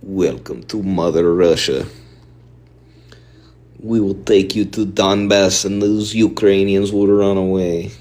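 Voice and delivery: put-on Russian accent on request; amateur Russian accent, deliberate. Shown as a demonstration. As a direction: welcome them in a mock Russian accent. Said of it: "Do the Russian accent again".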